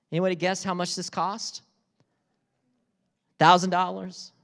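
ordinary talk

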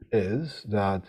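normal talking voice

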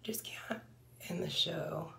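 applicator touching lips; careful lip movement; talking while applying lipstick. Real, not acted. Seen 2: Lip gloss wand pressed to lips throughout the spoken words.

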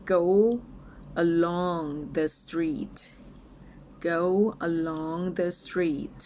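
word-by-word model for repeating, with pauses; slow and clear. Shown as a demonstration. English teacher models a phrase for learners, slow and clear. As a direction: say it slowly, each word apart. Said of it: "ช้าๆ ชัดๆ ไปก่อนนะคะ".

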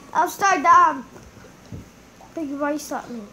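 ordinary delivery

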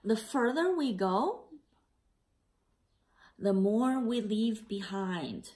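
put-on a gentle read-aloud for children; story narration. A narrator's reading. Teacher reading the class a picture book, gentle narration.

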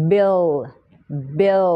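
bill with a clear L, tongue touching the palate; final L. Shown as a demonstration. Teacher showing the tongue position for bill's L. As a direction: end bill on a full L. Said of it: "มีเสียง L ท้ายเสียงนะ ลิ้นแตะเพดาน".

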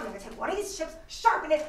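ordinary delivery

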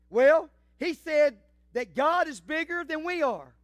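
a hint of an earnest kid, drawn out slowly; lightly voiced little girl. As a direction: lightly play a little girl telling Mama.

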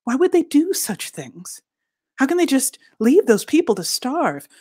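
high, swooping cries of disbelief; shocked exclamation. A narrator's reading. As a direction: exclaim each question, pitch leaping in appalled protest. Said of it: "the gleaners exclaim of us".

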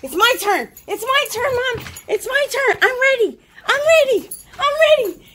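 squeaky, loud pleading dog voice; high dog voice. Pretend. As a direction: voice the eager dog begging for her turn. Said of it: "She says, Mom".

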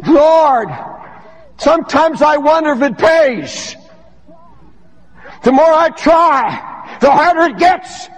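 high, impassioned preacher cry, drawn out; full-force preaching. Acted. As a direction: cry it to the Lord from the pulpit.

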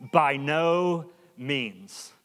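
BY NO MEANS drawn out slowly, word by word; emphatic. Retold or imitated. Preacher reading Paul's answer to his congregation, slow and emphatic. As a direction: stretch out "By no means" for firm emphasis. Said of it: "this is really emphatic".

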